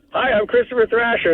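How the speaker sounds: high-pitched mock commercial greeting; put-on TV pitchman hello; Christopher Thrasher. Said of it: "I woke up to this guy talking".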